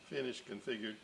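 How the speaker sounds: ordinary delivery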